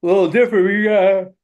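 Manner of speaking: words stretched by a yawn. Real, not acted. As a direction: talk through a yawn. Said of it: "Sorry, I was yawning there a little bit".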